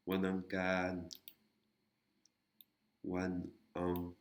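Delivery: slow, careful "Wan Ankan"; teacher's slow "Wan Ankan"; slow syllable demo. Shown as a demonstration. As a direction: spell out "Wan Ankan" slowly for learners. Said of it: "on a une voyelle courte là".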